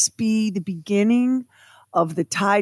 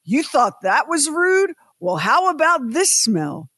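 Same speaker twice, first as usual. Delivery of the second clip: higher, mock-loud jeer; mild mock loud taunt; 7 semitones higher than usual. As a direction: retell the jerk's taunt to the cabin. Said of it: "the man said very loudly".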